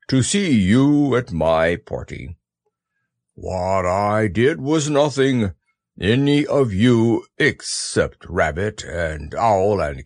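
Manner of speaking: slow, low solemn donkey; grand, gloomy speechmaking; pompous Eeyore speech. Acted. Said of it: "in an impressive way".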